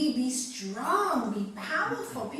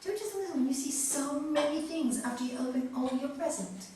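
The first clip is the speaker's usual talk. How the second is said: ordinary talk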